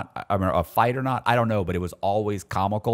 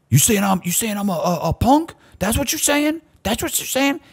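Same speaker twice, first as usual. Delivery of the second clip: mock aggressive inmate; 12 semitones higher than usual. Retelling the bipolar cellmate confronting him, high and aggressive. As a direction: push it up high like a hot-headed cellmate squaring up.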